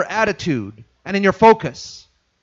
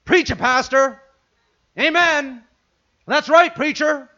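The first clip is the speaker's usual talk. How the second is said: higher, hammed-up churchgoer cheer; a lightly voiced eager congregant; mock amen corner; 6 semitones higher, slower than usual. Congregant cheering the pastor, lightly hammed-up. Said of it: "It takes no work to say".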